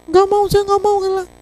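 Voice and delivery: high voiced wife begging not to lose her husband; pleading wife. Wife answers the pastor.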